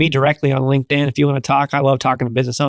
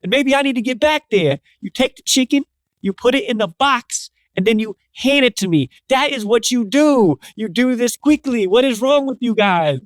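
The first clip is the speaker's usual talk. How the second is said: big customer scolding the cashier, hammed-up and raised high; lecturing big dude; 10 semitones higher than usual. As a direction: lay down the rules to the kid, exasperated. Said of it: "He's like".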